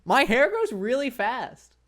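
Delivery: ordinary talk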